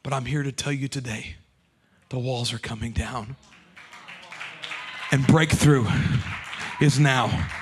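low, slow, quiet preaching over music. Acted. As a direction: build the congregation slowly. Opening exhortation over music.

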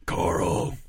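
low, gruff mock hero voice; Batman-style rasp; Rick. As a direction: growl the name, exaggeratedly gruff. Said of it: "his voice hasn't gotten that, that low".

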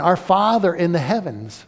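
usual speaking voice

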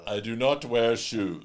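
slow, rounded celebrity impression; George Takei voice. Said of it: "That's my George Takei".

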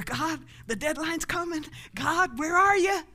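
high, loud frantic appeal; mock panic. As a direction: play a worried believer calling up to God. Acts out anxious believer calling God.

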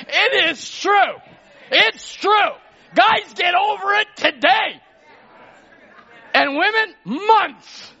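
high, loud shouted declaration; preaching at full force. Real, not acted. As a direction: hammer it home to the church.

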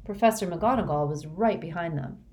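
ordinary delivery, nothing marked

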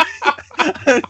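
helpless high-pitched laughing fit; can't stop laughing; hysterical laugh. Real, not acted. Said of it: "why are you laughing so hard".

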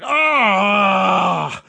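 loud, high pained "ah" scream; drawn-out cry from a wounded fighter. Acted. Orc screams with a javelin in his thigh. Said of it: "And he screams out".

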